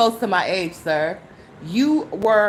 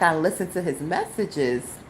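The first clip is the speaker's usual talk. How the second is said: ordinary talk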